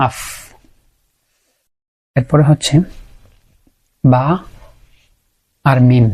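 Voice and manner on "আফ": আফ with the upper teeth on the lower lip; clearly articulated আফ, lip against the teeth; Arabic fa sound. Shown as a demonstration. Teacher showing students the fa letter, clearly articulated. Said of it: "এগুলো মিলে উচ্চারিত হচ্ছে ফা".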